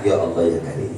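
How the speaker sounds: ordinary delivery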